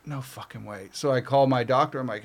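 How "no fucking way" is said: low, very soft retold reaction; quietly stunned, pitch dropped; hushed disbelief. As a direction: say it under your breath, in disbelief. Retelling dread at arm breaking again.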